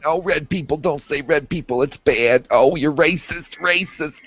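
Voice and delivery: high-pitched mock scolding; mocking outrage. Mocking people who object to words.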